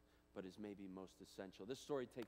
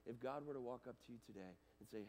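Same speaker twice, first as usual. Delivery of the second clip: ordinary talk, nothing marked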